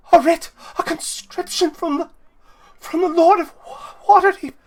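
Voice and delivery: very high, breath-caught astonishment; overwhelmed, squeaky disbelief; awestruck gasping. Acted. Wife breathlessly reads the writ.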